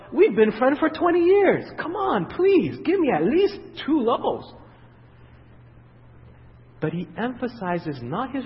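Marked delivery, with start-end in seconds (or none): 0.1-4.5 much higher, drawn-out whine; mock whiny begging. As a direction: beg like a wheedling old friend. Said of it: "He didn't say".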